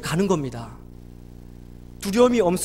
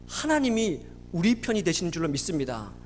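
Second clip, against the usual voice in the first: ordinary delivery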